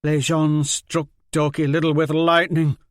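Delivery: sudden sharp outburst. Acted. Liz Hen breaks the tent's silence, sudden loud outburst. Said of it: "Liz Hen's voice cracked the silence".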